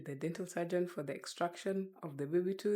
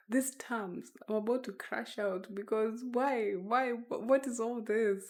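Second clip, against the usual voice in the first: exasperated; 6 semitones higher than usual. Real, not acted. Overwhelmed by dental terms.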